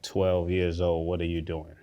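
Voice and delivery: usual speaking voice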